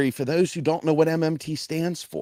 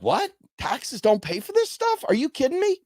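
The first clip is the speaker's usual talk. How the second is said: mock disbelief; 6 semitones higher than usual. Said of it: "They're like".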